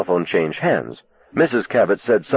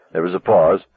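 plain reading voice

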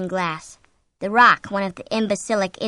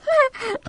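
very high, brief nervous giggle; shrill giggle. A narrator's reading. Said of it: "giggled shrilly".

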